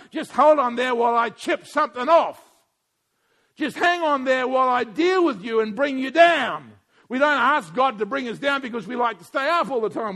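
loud, driving pulpit voice; fiery sermon delivery; preaching at full force. Acted. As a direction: preach it hard to the congregation.

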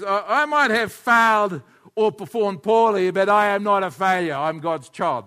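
loud, slow model line for the congregation to echo; loud lead-in to a call and response; declaration to repeat. Shown as a demonstration. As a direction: proclaim it slowly so they can repeat it. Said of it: "everybody say that with me".

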